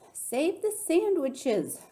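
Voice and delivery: high, drawn-out yell; urgent storybook yell. A narrator's reading. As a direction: call it out slowly, pitch soaring. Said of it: "Gloria Dump yelled to me".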